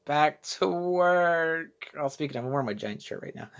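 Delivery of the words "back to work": drawn-out, very slow and glum; weary dread. Retold or imitated. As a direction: drag it out like you dread Monday. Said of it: "and I was just like".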